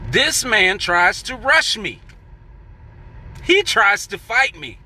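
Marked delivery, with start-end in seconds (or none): none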